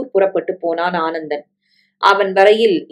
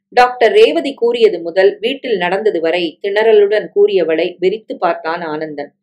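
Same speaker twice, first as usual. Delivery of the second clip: ordinary delivery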